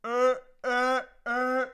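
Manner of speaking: high, loud strangled grunts; Tusken Raider impression. Streamer imitating a Tusken Raider for chat, high loud grunts. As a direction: bark out desert raider grunts. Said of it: "that was my best Tusken Raider impression".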